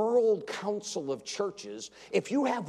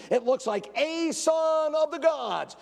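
mocking incredulity; 10 semitones higher, 4 dB louder, slower than usual. Mocking NIV reading, high.

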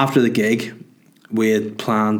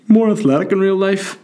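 high, louder put-on fan voice; drunk guy; 10 semitones higher, 4 dB louder than usual. Comedian reading his poster quote, high drunk-guy impression. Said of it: "just some drunk guy".

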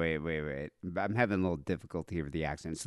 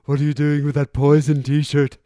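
loud, raised voice of a mock mom; hammed-up mom, loud and higher-pitched; mom walking in; 5 semitones higher, 10 dB louder than usual. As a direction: call out like a mom catching her kid. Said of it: "my, my mom never walked in".

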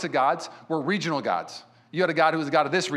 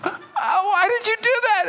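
high whiny voice of the hurt sister, much higher; 4 dB louder, slower than usual. Retold or imitated. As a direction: whimper it as the wounded little sister.

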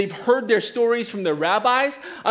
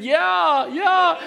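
eager yeah; 9 semitones higher than usual. Retold or imitated. Retold eager answer to wife.

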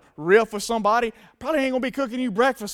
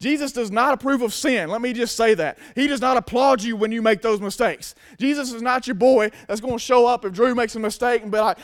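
ordinary delivery

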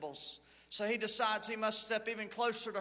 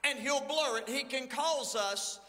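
lively raised pulpit preaching; 4 semitones higher than usual. Acted. Pastor preaching to his church about the middle road, fervent. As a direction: preach it out to the congregation.

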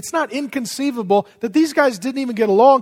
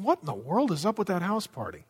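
snooty Pharisee gossip; 8 dB quieter than usual. Retold or imitated. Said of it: "they're standing down the street saying".

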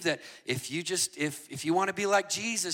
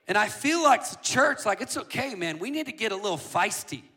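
ordinary delivery

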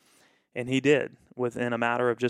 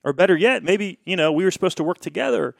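loud, exasperated protest; frustrated complaint; 6 semitones higher, 6 dB louder than usual. Retold or imitated. Pastor upset on the phone to the selling pastor, loud and frustrated. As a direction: vent the upset, louder and a bit higher. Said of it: "so frustrated, so upset".

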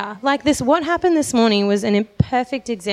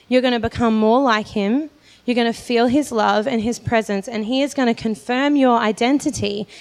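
ordinary delivery

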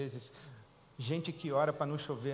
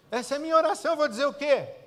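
higher and louder, anguished; desperate plea; 10 semitones higher, 8 dB louder, faster than usual. Retold or imitated. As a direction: cry out like a parent at a sick child's bed. Desperate parent praying for child.

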